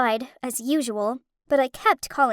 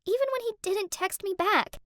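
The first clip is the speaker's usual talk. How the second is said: tearful; 6 semitones higher than usual. Acted. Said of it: "burst into tears upon hearing that".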